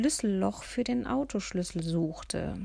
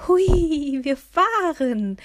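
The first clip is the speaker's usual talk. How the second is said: much louder, higher joyful "Hui"; thrilled the car finally moves; gleeful slow shout of delight; cheerful cry; 8 semitones higher, 10 dB louder, much slower than usual. A narrator's reading. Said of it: "rief das Fräulein fröhlich".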